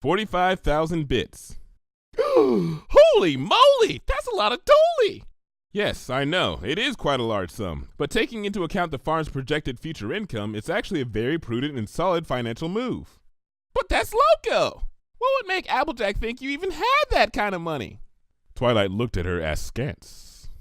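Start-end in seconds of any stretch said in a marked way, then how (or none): 1.9-5.2 gasp, then squeal it high and loud; shocked, can't believe the number; bubbly pony blown away by the sum; astonished gasp. Acted. Pinkie gasps at huge sum. 13.7-18.0 baffled exclamation. Acted. Pinkie baffled by loan request.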